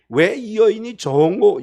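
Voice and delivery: usual speaking voice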